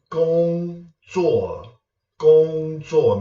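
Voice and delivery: "Gong" high and level, "zuo" falling; first plus fourth tone. Shown as a demonstration. Teacher giving viewers an example word, high tone then fall. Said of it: "our first tone with the fourth tone".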